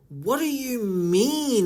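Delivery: high, drawn-out baffled question; confused. Real, not acted. Reader lost by the missing context, slow high-pitched confusion. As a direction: stretch it out slowly in bafflement. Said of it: "this is so confusing".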